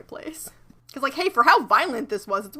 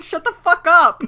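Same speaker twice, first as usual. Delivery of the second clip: higher, louder exasperated shout; loud mock snap; 7 semitones higher, 11 dB louder than usual. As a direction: yell at her to stop. Snapping at cartoon character.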